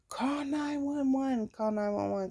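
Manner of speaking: a lady yelling up to call for help. Retold or imitated. Lady below urging her to call 911, high and urgent. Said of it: "all I remember her saying is".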